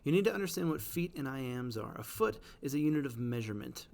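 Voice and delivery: normal talking voice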